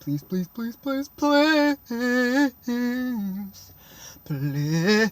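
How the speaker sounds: pleading that stretches into a quavering wail; hammed-up whimpering plea; mock-desperate begging. Kid praying bus stops at his street, mock-desperate begging.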